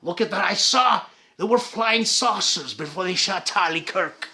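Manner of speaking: put-on a rough try at an American Jesse Ventura accent, half-hearted; Jesse Ventura impression. Mocking conspiracy talk, a half-hearted try at an American accent. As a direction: attempt his American accent though admitting you can't do it. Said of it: "How do you do his accent?".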